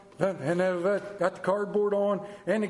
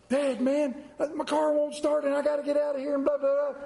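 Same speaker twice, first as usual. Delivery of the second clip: put-on teen asking dad; 8 semitones higher than usual.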